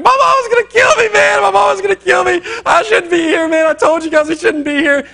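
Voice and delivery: very high, loud mock panic; hammed-up shriek of a panicking friend; freaking out. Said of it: "Daniel is freaking out at this point".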